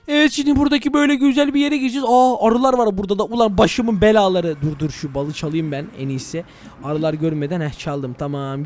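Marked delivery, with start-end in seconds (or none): 4.6-8.6 much softer, low sneaky murmur; sneaky hush. Real, not acted. Streamer sneaks honey past the bees, hushed. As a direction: hush it while stealing.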